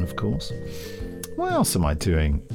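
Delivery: ordinary talk, nothing marked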